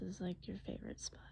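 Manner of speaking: faint breathy whisper. Acted. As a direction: let it fall to a whisper. [name] whispering.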